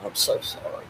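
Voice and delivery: real choked-up grief, voice mostly gone; soft, breaking into near-whispered sobs; fighting back tears. Real, not acted. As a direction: struggle to speak without crying. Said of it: "Try not to cry".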